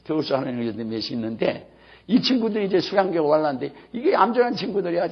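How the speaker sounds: normal talking voice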